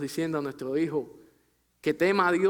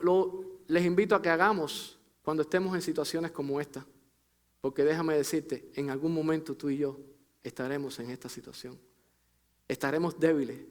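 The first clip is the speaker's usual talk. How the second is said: ordinary delivery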